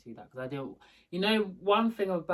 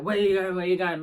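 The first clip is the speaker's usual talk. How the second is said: loud, raised challenge from a guard; acted urgent challenge; 4 semitones higher, 9 dB louder than usual. Security guard rushing at a visitor, urgent challenge. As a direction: mimic a guard urgently stopping someone.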